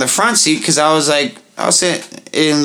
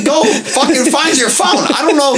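high laughing mock; 13 semitones higher than usual. Mocking drunk friend.

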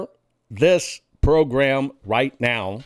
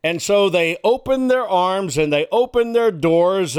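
normal talking voice

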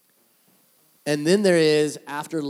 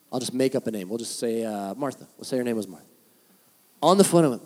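usual speaking voice

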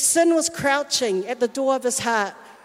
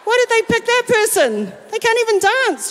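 higher, catty mock disdain; jealous sneer; 8 semitones higher than usual. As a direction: mimic a petty critic. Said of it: "you start to go".

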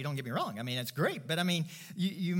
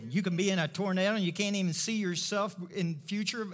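ordinary talk, nothing marked

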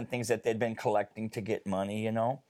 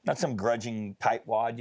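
normal talking voice

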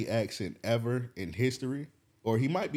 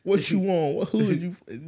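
higher, louder imitated confrontation; mock challenge; 8 semitones higher, 5 dB louder than usual. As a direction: bark it like the man in the stands. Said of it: "gang challenge retold".

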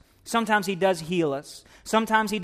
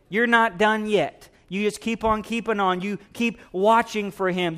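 rising, driving sermon voice, louder and higher; full-force preaching; 3 semitones higher, 3 dB louder than usual. Acted. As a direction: preach it out to the whole church.

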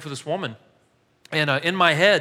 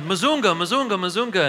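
a mock shout up the hill; imitated calling; 3 semitones higher than usual. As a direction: call out the name like a woman far off. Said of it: "I hear this person yelling".

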